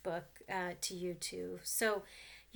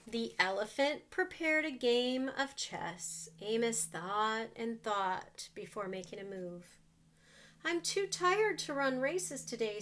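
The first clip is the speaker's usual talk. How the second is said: put-on reading to children; 3 semitones higher, 3 dB louder than usual. A narrator's reading. Reads picture book to children.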